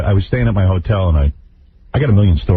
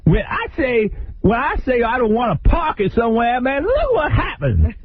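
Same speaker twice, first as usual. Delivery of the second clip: very high, slow squealing rant; hammed-up falsetto tantrum; mock screaming Bobby; 4 dB quieter than usual. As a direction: rant like a squeaky furious diva. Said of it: "So he's screaming".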